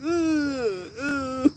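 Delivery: high-pitched mock whimper; hammed-up boo-hoo moans; imitated crying.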